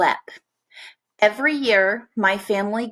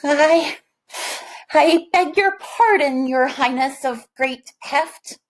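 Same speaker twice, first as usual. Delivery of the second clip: higher, hesitant and timid; nervous steward; 6 semitones higher than usual. A narrator's reading. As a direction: beg pardon of a scary king. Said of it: "raised a trembling hand".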